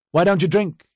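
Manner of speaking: high, loud demanding question. A narrator's reading. Husband to his wife who refused the toast, demanding. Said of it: "I demanded".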